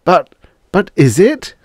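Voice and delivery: halting, high question; uncertain. A narrator's reading. Husband doubts the offer of life, uncertain. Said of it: "asked Pete uncertainly".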